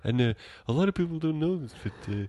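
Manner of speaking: rambling stoner lecturer impression; Adam Sandler. Actor's character gives a history lecture, goofy stoner impression. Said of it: "He's like".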